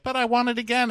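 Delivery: high, loud whiny plea; mock whiny plea. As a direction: beg like a needy boyfriend. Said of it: "And he's like".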